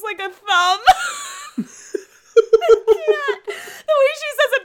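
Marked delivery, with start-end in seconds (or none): 0.9-1.5 high burst of laughter; laughing out loud. Real, not acted. Laughing fit at a line. 1.6-4.6 talking through high laughter; giggling through the words; laughing. Real, not acted. Laughing while recapping.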